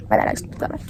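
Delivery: quick breathy aside to herself; whispered aside. Real, not acted. Girl catching herself mid-rant to viewers: whispered aside. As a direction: whisper the apology under the breath.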